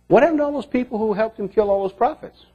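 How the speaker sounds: ordinary talk, nothing marked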